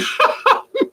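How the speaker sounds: high-pitched burst of laughter; a sudden high giggle; high squealing laugh. Real, not acted. Laugh at bird.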